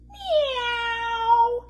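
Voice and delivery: long high sung meow; drawn-out wailing meow; cat yowl. Acted. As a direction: yowl like cats on a wall. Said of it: "sang the cats on the wall".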